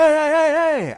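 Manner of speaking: loud high shout of warning; screaming hey at a coworker; warning yell. Retold or imitated. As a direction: yell to stop someone in the way.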